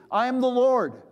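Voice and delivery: high, loud proclamation; grand heavenly announcement; the Lord. Acted. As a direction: boom it out from above. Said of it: "he hears the voice says".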